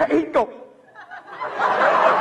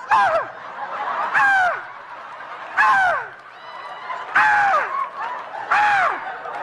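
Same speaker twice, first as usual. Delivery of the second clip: dog screaming; much slower than usual. Retold or imitated.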